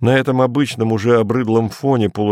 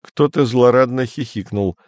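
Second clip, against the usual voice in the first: usual speaking voice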